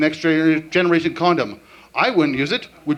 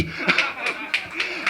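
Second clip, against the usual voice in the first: breathy laugh; 5 semitones higher, 6 dB quieter than usual. Real, not acted. Laughs after voice.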